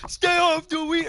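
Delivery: high, loud, slow mock scream; hammed-up sports pundit yell; mock Stephen A. scream. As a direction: bellow it like a TV pundit losing it. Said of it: "when he screams".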